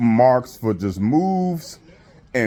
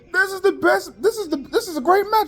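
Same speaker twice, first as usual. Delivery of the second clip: much higher, hyped announcer mock; Tony Schiavone yelling. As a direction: overhype it like a paid wrestling announcer. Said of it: "He yells".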